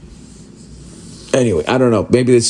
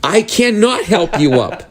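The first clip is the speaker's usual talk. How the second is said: high, slow put-on service voice; stiff refusal; 6 semitones higher than usual. Service worker answering someone hanging from a cliff, stiff refusal. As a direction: refuse slowly, pitched up.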